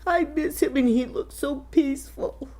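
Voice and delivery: high, soft voice breaking into tears; tearful and missing someone deeply; real crying. Real, not acted. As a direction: speak softly through grief. Mother grieves son in casket.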